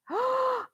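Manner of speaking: delighted gasp. Pretend. Delight after pretend tea.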